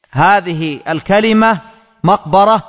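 ordinary talk, nothing marked

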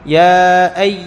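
"أي" drawn out as a gliding y; open ya. Shown as a demonstration. Teacher has students notice the gap, "أي" held open. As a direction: hold the y of "أي" without closing it. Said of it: "لاحظ، تجد فراغ".

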